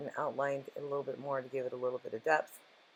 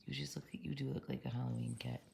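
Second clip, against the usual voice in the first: soft whisper to the cat; 2 semitones lower, 8 dB quieter than usual. Real, not acted. To her cat in her lap, a gentle whisper. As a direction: whisper so as not to disturb him.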